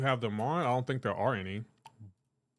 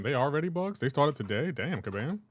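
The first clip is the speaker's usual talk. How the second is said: ordinary delivery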